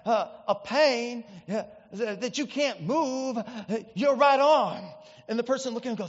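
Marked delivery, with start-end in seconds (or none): 0.0-4.8 put-on North Carolina TV healer accent; stretched North Carolina preacher drawl, building on the last words; Ernest Angley's accent. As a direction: imitate a Southern faith healer, halting and drawn out. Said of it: "like, in his great accent".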